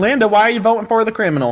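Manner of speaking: drawling mock country accent, lightly done; country accent slipping in and out; put-on country accent. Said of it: "whatever accent that was".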